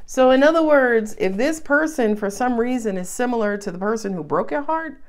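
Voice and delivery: ordinary talk, nothing marked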